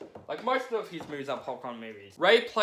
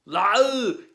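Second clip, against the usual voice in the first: loud, high monster roar; Predator noise; 6 semitones higher, 10 dB louder than usual. Pretend. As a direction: roar like the alien hunter. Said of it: "That's my stupid Predator noise".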